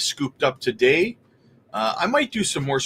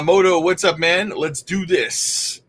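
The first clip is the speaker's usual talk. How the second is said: ordinary talk, nothing marked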